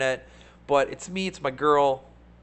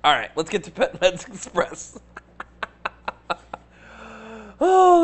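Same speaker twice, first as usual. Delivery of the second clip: laughing; 3 semitones higher than usual. Real, not acted. Turning to the next stock, laughing. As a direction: say it through a chuckle. Laughs while switching topic.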